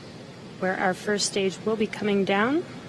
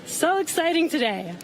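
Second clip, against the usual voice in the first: excited; 7 semitones higher than usual. Real, not acted. Host thrilled after landing.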